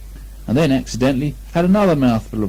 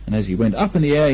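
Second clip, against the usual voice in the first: usual speaking voice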